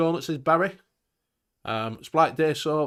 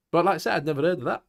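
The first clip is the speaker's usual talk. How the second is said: usual speaking voice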